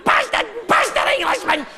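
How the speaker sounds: very high-pitched yapping Scotsman; yappy terrier. Acted. Type two Scotsman abusing the English, yappy terrier. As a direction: yap it like an angry little terrier. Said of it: "your little yappy terrier jock".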